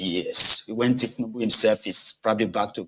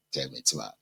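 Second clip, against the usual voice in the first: normal talking voice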